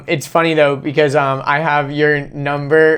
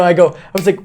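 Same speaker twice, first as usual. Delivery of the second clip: normal talking voice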